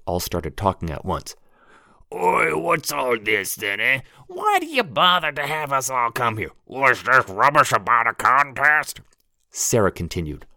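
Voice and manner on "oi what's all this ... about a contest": rough London thug heckling; Cockney thug. Acted. Narrator voices a crowd thug.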